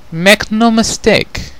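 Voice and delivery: slow, high, commanding queen voice; a regal mother laying down the law; stern queen. Acted. Queen warning her daughters, slow and commanding.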